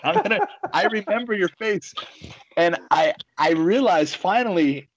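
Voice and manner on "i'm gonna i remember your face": mock menacing threat. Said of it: "You know, like".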